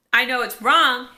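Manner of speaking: very loud insistence; mock argument. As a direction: act out a sister's loud objection. Acted sisters' quarrel.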